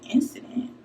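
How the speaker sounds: breathless whisper of the word; a stunned whispered echo; whispered disbelief. Retold or imitated. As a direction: mouth it back in shock. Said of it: "So I'm looking like".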